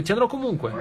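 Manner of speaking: retold cry of defiance. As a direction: imitate a defiant cry without raising the volume. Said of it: "E gridò e disse". (grido di sfida riferito, acuto ma non forte)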